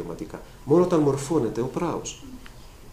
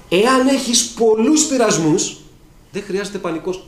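preaching at full force; 9 semitones higher, 7 dB louder, slower than usual. Acted. Preacher exhorting the congregation.

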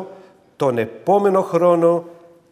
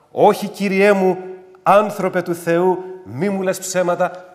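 raised, emphatic plea; 4 semitones higher, 5 dB louder than usual. Acted. Preacher requotes woman's doubt.

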